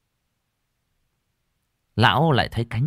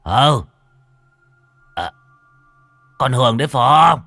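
louder, nervous stammered calls; stammering fear; 3 semitones higher, 7 dB louder than usual. Acted. Old man calling frightened into the dark, stammering. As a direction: call out into the dark, shaken. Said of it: "Lão khẽ lắp bắp".

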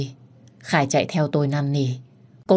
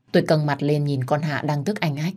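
ordinary delivery

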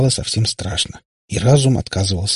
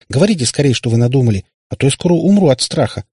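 higher, louder exasperated plea; losing patience; 6 semitones higher, 5 dB louder than usual. A narrator's reading. Katya demands the plan before fear kills her, impatient. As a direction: urge them on, at the end of your rope. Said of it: "не выдержала в конце концов Катя".

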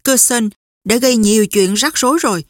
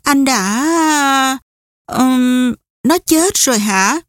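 hesitant question, higher, with an "ừm" midway; faltering; 4 semitones higher, much slower than usual. A narrator's reading. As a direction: falter and draw out the words before daring to ask. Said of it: "Nàng ấp úng". (ngập ngừng, ấp úng)

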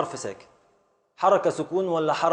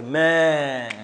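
long drawn-out "ما"; "ما" stretched as one isolated syllable; syllable demonstration. Shown as a demonstration. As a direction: hold the vowel of "ما" steadily. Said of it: "ده تعبير عن حرف متحرك".

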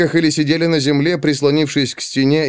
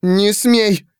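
high, indignant order; indignant warning; 8 semitones higher than usual. A narrator's reading. Pandora to the advancing servant, indignant warning. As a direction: forbid him with a sharp high cry.

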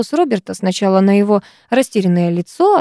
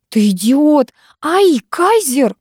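rising into a high, drawn-out yelp; exasperated outcry; 6 semitones higher, much slower than usual. A narrator's reading. Girl yelping at boy who grabbed, exasperated. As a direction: insult breaking into a slow 'ow'. Squabble, hair gets caught.

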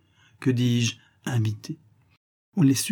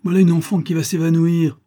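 higher, louder anxious outcry; alarmed cry; 7 semitones higher, 6 dB louder than usual. A narrator's reading. Uncle fearing his granddaughter will faint, a loud higher alarmed cry. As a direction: sound the alarm over the girl.

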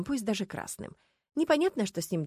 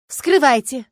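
loud, raised-pitch order; commanding; 5 semitones higher, 12 dB louder, slower than usual. A narrator's reading. Starkova ordering the coffin opened, imperious. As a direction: command it sharply, at full voice. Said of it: "велела она".

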